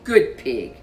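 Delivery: ordinary delivery, nothing marked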